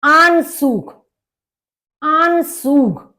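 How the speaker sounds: आनसूग high and drawn out; German word demo. Shown as a demonstration. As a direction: stretch आनसूग for learners. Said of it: "ए का आ हो गया और जेड को सा". (आनसूग धीरे और खींचकर)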